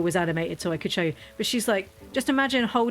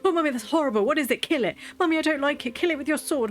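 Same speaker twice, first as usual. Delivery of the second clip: put-on high, panicky little girl voice; scared child; 7 semitones higher than usual. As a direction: squeal it like a frightened toddler. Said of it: "And she was like".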